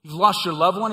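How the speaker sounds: ordinary delivery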